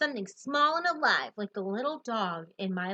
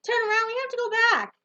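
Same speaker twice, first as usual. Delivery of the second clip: panicked yelling; 11 semitones higher, 5 dB louder than usual. Acted. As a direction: beg the driver to stop, yelling. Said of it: "yelling stuff like".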